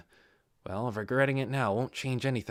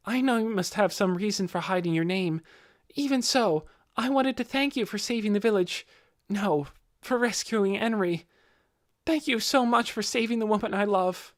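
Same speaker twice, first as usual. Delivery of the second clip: higher, grateful youthful voice; a sincere boy thanking his rescuer, pitched high; earnest young man; 9 semitones higher than usual. Acted. Young man thanks Ainz again.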